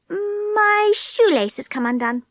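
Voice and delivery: put-on slow, high girl's voice calling up; high child's voice calling ahead; little girl calling. A narrator's reading. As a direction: call out a fib to the others. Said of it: "she called to the others".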